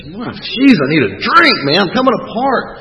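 high-pitched, coming-apart desperation; worked-up, strung-out plea; frantic craving. Retold or imitated. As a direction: blurt it like you're about to crack. Reenacts his frantic craving.

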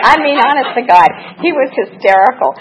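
can barely get it out for laughing; still laughing. Real, not acted. Telling the mourners how funny John was, laughing. Laughing over John's tail joke.

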